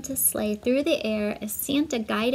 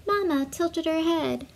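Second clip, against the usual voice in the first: plain reading voice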